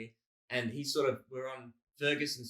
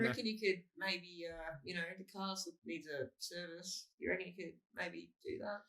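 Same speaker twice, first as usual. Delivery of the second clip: Australian dad; 6 semitones higher, 8 dB quieter than usual. Retold or imitated. Dad asking son to get the car serviced, imitated tentatively. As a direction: ask gently, pitched up, as an Australian dad. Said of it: "my dad's a sort of Australian dad".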